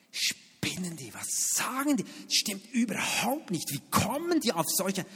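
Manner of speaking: slow, outraged mutter of the wounded soul; indignant inner voice. Retold or imitated. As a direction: let the offended soul bristle. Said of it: "meine Seele wandert langsam hervor".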